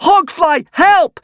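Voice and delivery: high, loud desperate scream; screamed for help. Acted. Hiccup calling the Hogfly to save him, screaming high. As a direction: shriek for the Hogfly in terror. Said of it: "screamed the hopping Hiccup".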